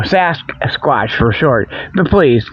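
usual speaking voice

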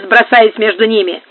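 plain reading voice

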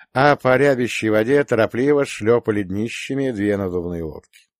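plain reading voice

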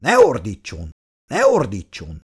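policeman's repeated angry order, low-pitched; angry shout. A narrator's reading. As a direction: bellow it twice, restrained by narration. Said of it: "dühösen ráordított kanclerre".

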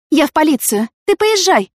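high, loud, hurried announcement; calling back. A narrator's reading. Yana running to the police, calling back urgently.

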